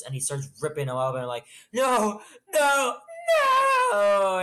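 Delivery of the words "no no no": high, loud mock wail of "no"; horrified wail. Player watching the armor get ripped up, mock-horrified wailing. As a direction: cry out in hammed-up horror, drawing out the last one.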